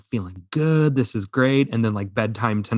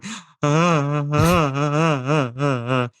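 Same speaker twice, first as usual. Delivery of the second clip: imitating toddler crying; 4 semitones higher, slower than usual. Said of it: "he's bawling his eyes out".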